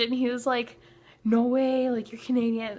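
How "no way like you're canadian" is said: imitating an excited tour manager, higher; higher-pitched, amazed reaction; hyped. Tour manager learns she is Canadian, hyped. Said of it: "he got so hyped".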